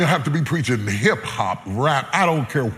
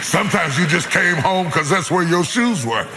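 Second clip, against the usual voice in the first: raised, higher preaching voice, playful; 4 semitones higher than usual. Acted. Preacher teasing the congregation about married life, raised preaching voice. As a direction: preach it up to the crowd with a grin.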